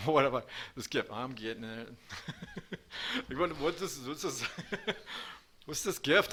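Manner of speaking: breaking into laughter mid-phrase; laughing. Real, not acted. Speaker overcome while describing his gift, laughing. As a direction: try to speak through the giggles, restarting.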